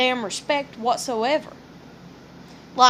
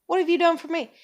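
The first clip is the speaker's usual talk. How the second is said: raised mocking challenge; hammed-up sass, pitched up; smart-ass retort; 4 semitones higher than usual. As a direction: throw it back at her, sassy. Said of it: "that's the smart ass in me".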